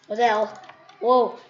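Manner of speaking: loud startled what the hell; watching a great play, a loud shocked outburst. Real, not acted. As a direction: blurt it out in amazement. Reacting to gameplay.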